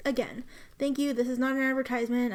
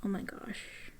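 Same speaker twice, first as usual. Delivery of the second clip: awed whisper; 2 semitones lower, 8 dB quieter than usual. Real, not acted. Admiring the pen up close.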